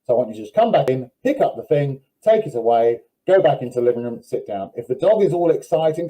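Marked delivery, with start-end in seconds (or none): none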